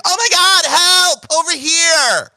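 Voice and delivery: very high, loud cries for help; screaming woman. Acted. Woman calling the officers over, high and screaming. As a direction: shriek to draw the officers. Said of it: "She's like".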